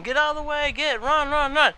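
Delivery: high, urgent call to run; urgent warning shout. Retold or imitated. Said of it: "so you tell him".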